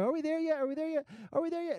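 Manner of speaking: put-on high, pleading child voice; whiny kids. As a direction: whine it like an impatient kid. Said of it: "the kids are always like".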